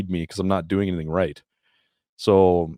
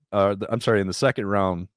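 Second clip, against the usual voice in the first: normal talking voice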